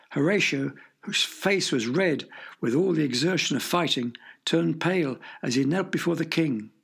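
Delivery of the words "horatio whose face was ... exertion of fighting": plain reading voice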